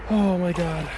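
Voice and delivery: high, gasping cold shock; shocked by icy water. Real, not acted. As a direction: yelp at the freezing water.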